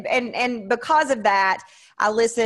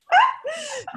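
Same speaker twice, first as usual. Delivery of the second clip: high burst of laughter. Real, not acted. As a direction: laugh at yourself. Laughing at herself.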